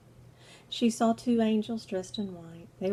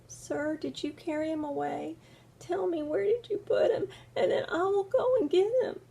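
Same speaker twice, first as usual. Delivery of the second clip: pleading Mary; 8 semitones higher than usual. Acted. Mary pleading in retold story.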